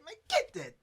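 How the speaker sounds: high, sharp command; retold order. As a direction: shove him forward with a snapped order. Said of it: "Grabbed him by his shirt and said".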